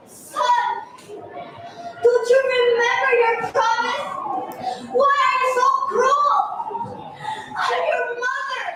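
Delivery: mother begging her son to remember; begging sadly. Acted. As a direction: plead with a cruel son. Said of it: "His mother begged sadly".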